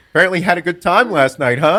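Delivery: loud, high-pitched mock interrogation; accusing dad. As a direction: imitate a dad calling out his son. Dad confronting him, acted.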